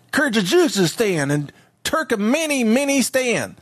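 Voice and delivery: slow, high mock Southern drawl, mangling country names; hammed-up Southern senator teaching a class, stumbling over names; Lindsey Graham. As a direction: drag out every -stan in exaggerated Southern twang. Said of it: "that's how Senator Graham would".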